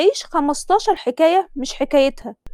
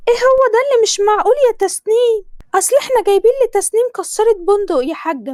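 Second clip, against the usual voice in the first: higher, louder pushy Egyptian mother; a mother hurrying her daughter, pitched up; Tasneem's mother; 9 semitones higher, 3 dB louder than usual. Acted. Mother voiced in story. (صوت أم مصرية عالي ومستعجل)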